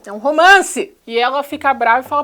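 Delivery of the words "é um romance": loud, higher "É um romance!" with falling end; sarcastic exclaim. Real, not acted. Vlogger mocking the film to viewers, loud sarcastic cry.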